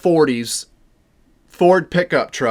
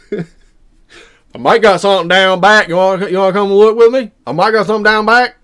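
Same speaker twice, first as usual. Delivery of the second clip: backwoods guy voice; 4 semitones higher, 5 dB louder than usual. Retold or imitated. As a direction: play the rough local man. Retold backwoods man offering help.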